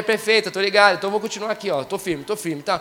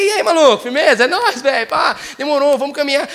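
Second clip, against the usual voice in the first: high, loud street greeting; casual buddy greeting; 6 semitones higher, 6 dB louder than usual. Shown as a demonstration. Greeting a buddy, loud casual street slang. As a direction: hail a friend across the street, loud and high. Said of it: "não, mas chega".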